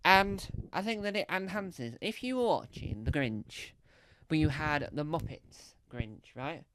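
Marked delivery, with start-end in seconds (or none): none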